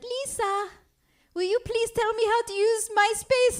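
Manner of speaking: high-pitched comedian impression; Yakov Smirnoff. Comedian asking a friend for tips, high-pitched impression. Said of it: "I'll do an impression of the last comedian".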